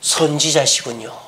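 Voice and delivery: soft and slow, hushed with awe; "선지자시군요" said softly and slowly, a respectful realisation; reverent. Acted. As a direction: say it in quiet wonder. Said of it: "이 여인은 어떻게 해요?".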